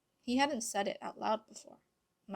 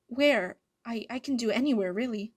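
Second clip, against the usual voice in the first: loud, hurried, stammering eagerness; spat out; 2 semitones higher, 8 dB louder than usual. A narrator's reading. Adrien agreeing to meet his mother, blurting eagerly. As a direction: blurt it out fast, tripping on the start. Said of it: "he spat".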